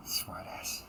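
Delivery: a quick whispered insult under the breath; whispered jab. Acted. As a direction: mutter it so only they hear. Insult aside.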